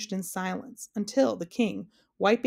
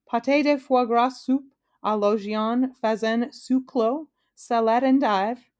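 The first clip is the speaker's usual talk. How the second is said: slow, careful French menu, pitched up; 4 semitones higher, 4 dB louder, slower than usual. A narrator's reading. King ordering his French banquet dishes, careful. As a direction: pronounce each dish with deliberate care. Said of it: "he said carefully".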